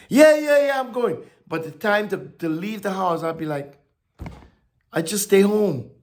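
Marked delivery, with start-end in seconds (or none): 0.1-1.2 eager yes. Retold or imitated. Eager reply to friends' party call.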